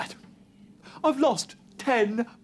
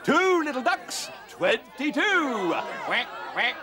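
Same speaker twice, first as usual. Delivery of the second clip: shouted bingo call with quacks; bingo caller; 3 semitones higher than usual. Pretend. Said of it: "if I could have a go at calling".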